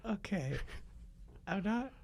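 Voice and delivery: put-on high, curious kid voice; a wide-eyed kid needling Santa; child's voice. Child up late on Christmas Eve questioning Santa, high kid voice.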